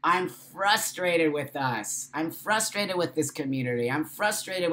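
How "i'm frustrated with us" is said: usual speaking voice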